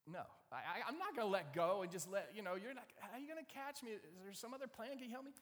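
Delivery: high, stammering, loud at first; panicky refusal. Acted. Man on the cliff refusing to let go, flustered. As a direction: balk and plead in mock panic.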